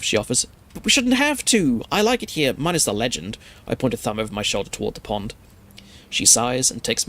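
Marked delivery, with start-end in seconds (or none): 0.7-3.4 exasperated insistence, falling back by the end; pleading protest. A narrator's reading. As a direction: protest with the pitch leaping up, then settling. Protesting in acted quarrel on porch.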